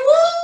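playful high whoop; excited woo. Real, not acted. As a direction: let out a long, delighted "woo". Playing with the fluffy dog page.

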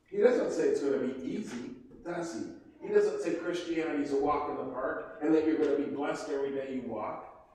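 usual speaking voice

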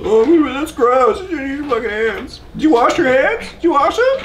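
high whiny voice, hammed up; mock disgusted viewer. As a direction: whine like a grossed-out viewer. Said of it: "people just go".

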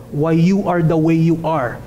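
ordinary talk, nothing marked

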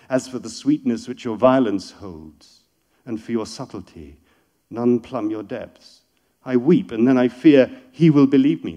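loud, fervent declamation; poetry reading. Acted. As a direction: speak the lines as a lover to God. Reads the verse aloud.

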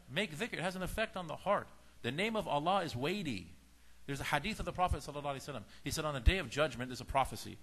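ordinary delivery

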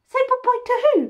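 very high, fast squeaky cat voice; voicing the cat. Owner voicing the confused cat, high and fast. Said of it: "She's like".

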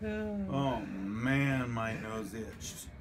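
loud then very slow; slow groggy drawl. Real, not acted. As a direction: drag the words out, dopey. Loopy patient recalls itch.

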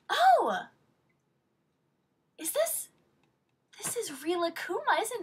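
high, loud gasp of discovery; can't believe what you've just found; delighted surprise. Real, not acted. Surprised by unexpected Rilakkuma.

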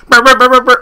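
loud high brr siren; loud imitated siren noise; mock siren effect. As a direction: imitate a wild alarm sound. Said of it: "if I had the wilding out sound effect".

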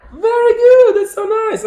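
high, loud delighted praise; excited praise. Real, not acted. Teacher praising young child.

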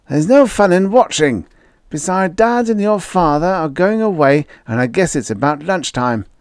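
put-on high, bright-eyed boy showing off his plan; a young boy playing it virtuous; eager schoolboy voice; boyish Red voice. A narrator's reading.

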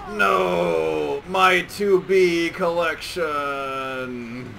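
loud, very slow, stretched-out shout; fighting game voice. Retold or imitated. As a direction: bellow it like a boxer taunting. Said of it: "I had my own, like, version of that".

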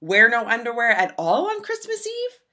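ordinary delivery, nothing marked

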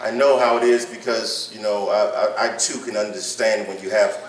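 low, hushed comfort; consoling preacher. Acted. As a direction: ease the worried ones gently. Softer, lower consoling stretch.

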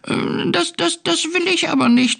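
tiny, very high kitten voice; squeaky, stammering little cat; peeping. A narrator's reading. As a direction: peep it hesitantly, right at the top. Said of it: "piepste Findus".

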